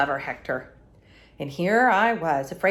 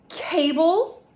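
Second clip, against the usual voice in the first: much higher, louder shocked Cable?; 10 semitones higher, 5 dB louder than usual. Acted. Narrator discovering the killer's name, shocked high cry. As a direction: gasp out the name in alarm.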